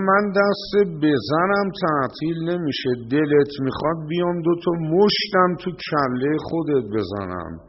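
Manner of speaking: angry thug's threat. Retold or imitated. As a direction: threaten the imam like a street bully. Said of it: "گفت". (تهدید خشمگین جوان لات با فریاد)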